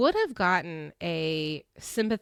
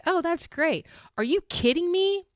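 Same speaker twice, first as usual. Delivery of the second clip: mock sarcastic retort; 8 semitones higher than usual. Quoting Brandy's mocking retort.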